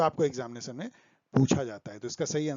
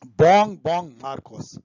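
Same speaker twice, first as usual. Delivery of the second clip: loud announcement; 10 dB louder than usual. Real, not acted. Announcing the new president's name.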